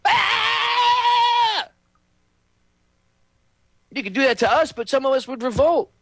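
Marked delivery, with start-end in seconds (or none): 0.0-1.7 high mock shriek of a boiled lobster; lobster scream. Imitating a lobster screaming as it boils, high shriek. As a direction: shriek like a lobster hitting the water.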